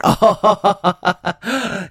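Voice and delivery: smug triumphant laugh; loud smug cackle; I told you so laugh. Acted. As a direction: gloat with a laugh. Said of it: "the "I told you so" laugh".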